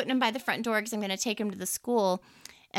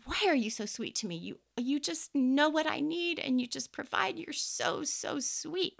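retold tender praise; 6 semitones higher than usual. Speaker retelling her prayer to God, tender and hushed. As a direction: tell God he is so sweet, voice dropping to a hush. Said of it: "I even said it out loud".

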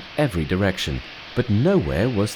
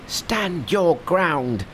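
high slow monkey hiss, mild; Monkey steadies himself before the dragon; 9 semitones higher, much slower than usual. Acted. Monkey faces the dragon, mild high hiss. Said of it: "hissed Monkey".

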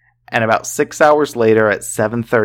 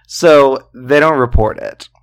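ordinary talk, nothing marked

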